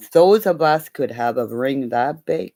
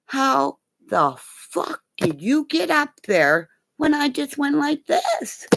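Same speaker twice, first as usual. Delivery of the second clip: incredulous yell; 11 semitones higher than usual. Retold or imitated. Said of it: "And I go".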